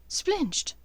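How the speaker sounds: high, sharp cry; Hermione alarmed. Acted.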